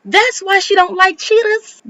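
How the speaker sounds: high, emphatic punchline delivery; high-pitched realization. Acted. Host spelling out her discovery to viewers, high-pitched. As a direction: exclaim it in a high, incredulous pitch.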